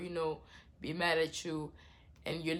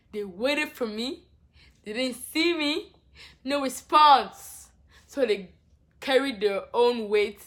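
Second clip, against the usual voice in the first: exasperated; 5 semitones higher, 10 dB louder, slower than usual. Real, not acted. Exasperated account of wasted trip.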